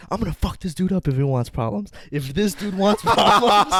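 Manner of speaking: loud, high, worked-up boast; aggressive threat. Retold or imitated. Furious fan to his five friends, threatening. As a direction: rile up your crew for a fight.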